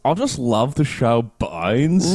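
hammed-up, drawn-out Australian accent; mock Aussie fan with stretched vowels. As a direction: put on a slow, broad Australian accent. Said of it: "doing his accent".

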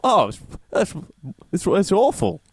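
high, slipping into British; a bad Aussie accent that drifts British; accent that comes out British; mock Australian. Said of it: "I'm making Australia sound British".

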